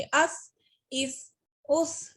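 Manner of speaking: अस with a soft lisped s; soft sa sound. Shown as a demonstration. Teacher to students, soft lisped s sound. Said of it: "इसको हम soft ही पढ़ेंगे".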